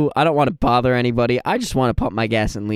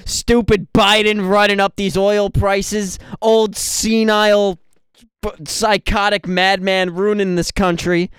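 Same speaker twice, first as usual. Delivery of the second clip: ranting stranger; 6 semitones higher than usual. Retold or imitated. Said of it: "And he goes".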